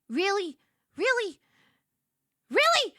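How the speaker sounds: incredulous really, going up each time; rising disbelief. Retold or imitated. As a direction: build from doubt to a squeal of disbelief. Disbelief at free flowers offer.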